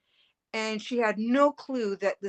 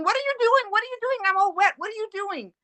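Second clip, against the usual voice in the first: much higher, agitated mock scolding; imitating angry mother; 12 semitones higher, faster than usual. Confused mother scolding her daughter for soaking her, imitated angry. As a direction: play the confused mother snapping back, indignant. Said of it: "she's getting angry with me and she's saying".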